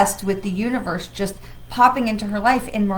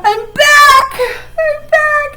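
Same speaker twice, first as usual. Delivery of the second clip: high, loud happy announcement; excited; 10 dB louder, slower than usual. Real, not acted. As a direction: burst out with it, thrilled. Announcing return to viewers.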